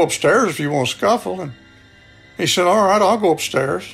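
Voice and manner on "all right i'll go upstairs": usual speaking voice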